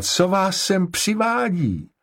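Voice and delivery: high, slow exclaimed question; zvolání. A narrator's reading. (vysoko a protáhle zvolaná otázka)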